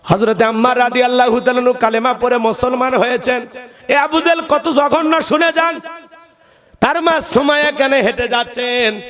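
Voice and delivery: high, melodic sermon storytelling; impassioned preaching. A narrator's reading. Preacher telling listeners of Ammar's conversion, impassioned preaching.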